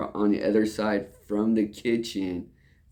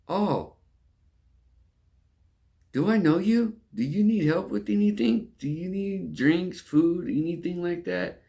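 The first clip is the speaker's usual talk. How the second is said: a far higher, sugary server voice; mock polite waiter; 10 semitones higher than usual. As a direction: ham up the eager waiter, pitched way up. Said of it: "And then he's like".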